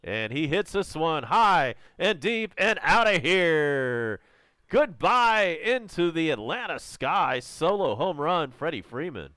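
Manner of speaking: loud, soaring home run call; loud high play-by-play shout. Real, not acted. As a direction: belt it out as the ball leaves the park.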